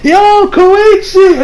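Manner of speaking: high, slow drawn-out call across the cafe; Okuyasu's greeting. Retold or imitated. Okuyasu spots Koichi at the cafe and calls out, high and drawn out. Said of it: "Okuya's like".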